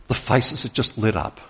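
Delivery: ordinary talk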